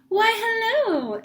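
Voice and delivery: high, slow, sugary greeting; gushing neighbor. A narrator's reading. Neighbor exclaiming over Ramona in high slow voice. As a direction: sing out the hello. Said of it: "exclaimed Missus Whistler".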